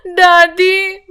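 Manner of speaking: slow, tearful "दादी" drawn out; crying child. A narrator's reading. Granddaughter calls sick grandmother, slow and tearful. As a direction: sob the word "दादी" slowly.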